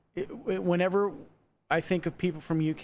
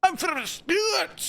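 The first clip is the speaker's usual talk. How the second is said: mock clan shout; 12 semitones higher than usual.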